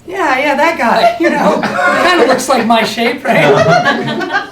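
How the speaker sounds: fake-brag to mom through laughter; mock proud son. Son's imagined chat with mom.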